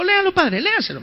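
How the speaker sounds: high, coaxing whisper-like push; lightly voiced elbowing neighbor; nudging parishioner.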